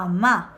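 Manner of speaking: "Ama" said loud and low as a-MA; stress on final A. Shown as a demonstration. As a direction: put the weight on the last A of "Ama". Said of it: "cargo acá el tono en la última A".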